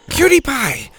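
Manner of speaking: high, projected call. A narrator's reading. Bo calls the pig from the tree, loudly. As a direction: call to an animal down below. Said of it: "Bo called down to her".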